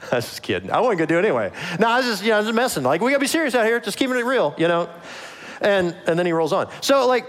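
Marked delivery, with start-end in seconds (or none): 0.1-4.9 high, nervous backtracking; mock backpedaling Saul. Saul to his armed soldiers, high nervous backpedaling. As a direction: laugh it off, pitched way up.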